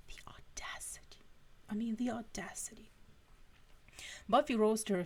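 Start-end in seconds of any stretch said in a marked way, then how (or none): 0.1-2.9 hushed, scandalised whisper; whispered aside. Real, not acted. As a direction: drop to a whisper in mock outrage. Reader's hushed aside on story.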